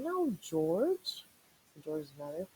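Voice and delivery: high, patient but fed-up mother scolding her pup; exasperated mom. Acted. Mother scolding her puppy George, exasperated and high. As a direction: scold gently like a tired mom. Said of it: "I could hear her saying".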